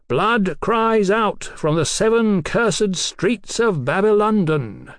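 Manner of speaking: high, slow, ringing declamation; oratorical denunciation. Acted. Captain denounces the doctor's civilization, declaiming. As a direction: denounce him like a prophet of doom.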